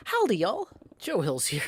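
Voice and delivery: high yipped greeting, dropping back; wolf yip. Pretend. Streamer greeting viewers in wolf noises, yipping. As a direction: yip the hello like a little wolf. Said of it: "Oh, those are called yips".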